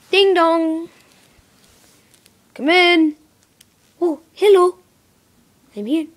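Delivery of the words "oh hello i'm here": ordinary talk, nothing marked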